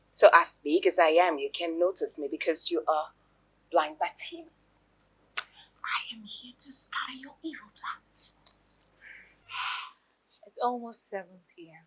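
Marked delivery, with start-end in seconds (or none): 3.7-8.3 whisper. Acted. Sneaky asides about the rival. 10.5-11.8 whisper. Acted. Scheming aside.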